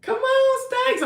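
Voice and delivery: high, loud surprised outcry; sudden squeal of surprise. Real, not acted. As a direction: shriek in delighted disbelief. Surprised reaction to comment.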